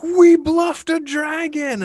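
mock triumphant crow; gleeful players. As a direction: stretch it out like a smug victory chant. Players gloating to the DM.

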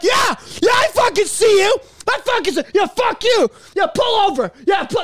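hammed-up furious driver; road rage. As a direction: yell at another driver. Angry driver taunting.

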